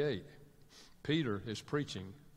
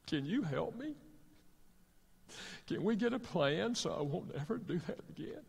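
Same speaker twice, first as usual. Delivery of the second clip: tearful remorse; 4 dB quieter than usual. Retold or imitated. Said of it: "he started crying".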